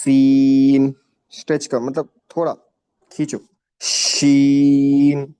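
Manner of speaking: سین and شین stretched long and loud; slow stretched letters. Shown as a demonstration. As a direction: drag out each letter slowly. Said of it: "سلو، آرام سے". (حروف کو آرام سے کھینچ کر)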